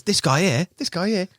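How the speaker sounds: high-pitched imitation of a mate's catchphrase; Tommy impression. Imitating a mate's running nose joke, teasing. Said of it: "I can't say it, but he goes".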